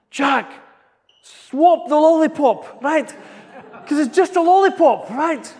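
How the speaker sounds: loud, high, slow shouting to his son; dad at a football game, shouting high and loud; urging shout. Retold or imitated. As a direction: yell encouragement from the back of the room. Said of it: "And so I'm starting to shout".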